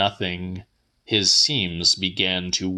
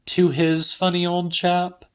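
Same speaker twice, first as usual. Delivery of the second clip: voiced much higher as the woman; Celia's voice; 10 semitones higher than usual. A narrator's reading. Woman telling the old man her news, higher voice. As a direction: lift the pitch well up for her lines.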